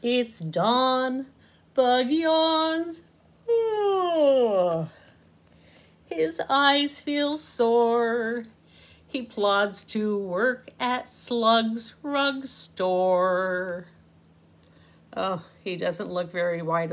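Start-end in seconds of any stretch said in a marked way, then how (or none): 0.0-3.0 slow, lilting storybook narration; reading to children. A narrator's reading. Narrator reading Bug in a Rug to kids, slow and sing-song. 3.5-4.9 high yawning oh; mock yawn. Bug waking sore-eyed at dawn, a yawn. 6.1-13.9 slow, lilting storybook narration; reading to children. A narrator's reading. Narrator reading Bug in a Rug to kids, slow and sing-song.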